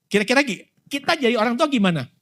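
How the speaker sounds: normal talking voice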